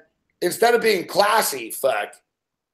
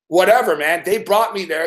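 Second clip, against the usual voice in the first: ordinary talk, nothing marked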